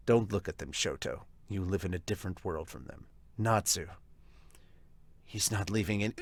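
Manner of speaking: low, quiet, even brother voice; steady and hushed, defending the father; calm younger brother. Acted.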